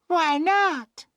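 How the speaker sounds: put-on very high girlish query; girl's voice. A narrator's reading. Emily still puzzled, high girlish questioning.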